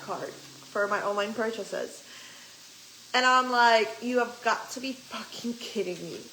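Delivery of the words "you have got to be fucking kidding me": exasperated disbelief. Retold or imitated. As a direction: say it like you can't believe this. Retold disbelief at card problem.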